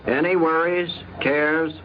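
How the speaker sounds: ordinary delivery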